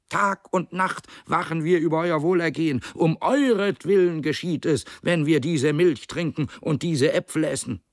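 high-pitched, insistent speech to comrades; persuasive pig orator. Acted. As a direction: play a smooth-talking pig spokesman, pitched well up. (hohe, eindringliche Rednerstimme eines Schweins)

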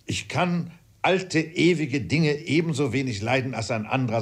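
high-pitched protesting father; worked-up father defending himself; indignant father. Acted.

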